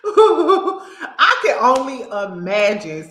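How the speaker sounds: high, loud, slow words through laughter; real helpless amusement, drawn out; laughing hard. Real, not acted. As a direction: crack up while saying it. Cracking up at the imagined scene.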